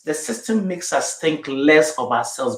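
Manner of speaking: ordinary talk, nothing marked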